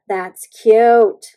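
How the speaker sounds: loud burst of delight. Real, not acted. Exclaiming to viewers over her nail, delighted. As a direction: blurt it out, thrilled.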